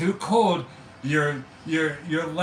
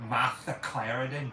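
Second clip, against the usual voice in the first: put-on Boston accent; 5 semitones lower, 3 dB quieter than usual. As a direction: lower Boston voice. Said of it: "Boston accent".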